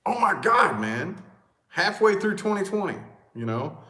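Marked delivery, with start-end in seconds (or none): none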